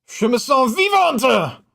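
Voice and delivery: put-on slow, high French-accented echo of the line; mock French echo. Streamer echoing a game voice line, high and slow. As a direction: savor the line in a sultry French voice. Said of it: "repeats voice line".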